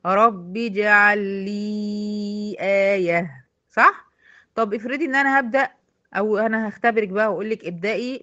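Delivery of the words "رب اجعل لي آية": slow tajweed reading, rabbi-jal joined; Quran recitation, joined. Shown as a demonstration. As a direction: recite slowly without the hamza. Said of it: "هنا في الوصل دخلت بالب المكسورة". (تلاوة بطيئة موصولة)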